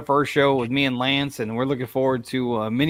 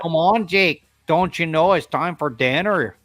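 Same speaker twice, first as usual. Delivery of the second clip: imitating wife's Minnesota accent; 4 semitones higher, 4 dB louder than usual. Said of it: "She's like".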